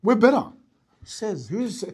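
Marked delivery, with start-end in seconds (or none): 0.0-0.5 higher, louder cocky boast; mock bragging. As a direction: sell it like a smug rival trainer. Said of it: "Why do you wanna train there for?".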